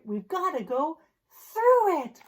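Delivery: usual speaking voice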